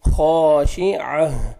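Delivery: खाशिअह very slow, heavy ख and ending in a ह sound; Quranic recitation. Shown as a demonstration. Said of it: "आइन को अपने सही मखरज से अदा करेंगे". (खाशिअह मोटे ख के साथ, आख़िर में ह)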